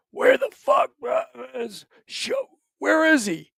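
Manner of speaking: high furious shout at the drivers; frantic angry major; angry yell. Retold or imitated. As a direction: yell it out, voice cracking high with rage. Major yelling at Afghan drivers.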